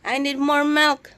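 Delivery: lightly voiced baby, high and loud; voicing the baby. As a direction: speak for the baby, mock-cute. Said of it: "voicing baby's words to daddy". (boses ng sanggol na may lambing)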